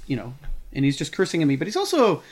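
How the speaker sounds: normal talking voice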